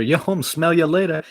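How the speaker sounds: loud high yell; yelled line. Acted. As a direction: shout it to the driver. Reading a rap line, yelling.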